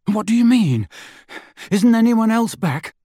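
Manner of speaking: panting. A narrator's reading. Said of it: "Harry panted".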